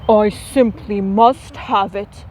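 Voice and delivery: a mild, understated wistful longing; wistful cry. Acted. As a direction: sigh it out, lightly longing for the pine cone. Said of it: "he wistfully cried".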